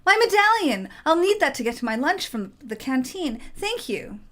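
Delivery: high delighted outburst; relieved and thrilled; excited. Acted. Relieved artificer gets medallion back.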